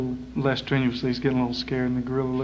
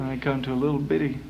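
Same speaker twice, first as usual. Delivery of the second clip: ordinary talk, nothing marked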